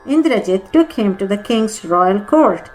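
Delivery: plain reading voice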